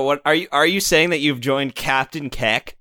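ordinary talk

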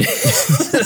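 high burst of laughter; laughing. Real, not acted. Laughing at own quote.

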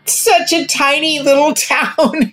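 high and slow, laughing through it; slow laughing words, pitched high. Real, not acted. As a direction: giggle at how small the town seemed. Laughing at her own fear.

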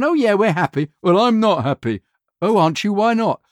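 high, eager agreeing with whoever sounds sure; fawning client. Retold or imitated. Ad clients caving to a confident critic in a high voice. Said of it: "And they've gone".

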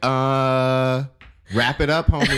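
high mock brush-off with a laugh; dismissive. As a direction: shoo them away, half laughing. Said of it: "people are like".

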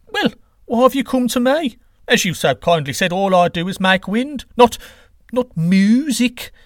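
defensive god, drawing out the last words; puzzled wind god. Acted. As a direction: protest that it is not your job.

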